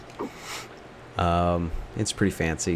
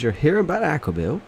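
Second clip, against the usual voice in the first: put-on high, quick old lady; 10 semitones higher, faster than usual. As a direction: peer over glasses like an old clerk.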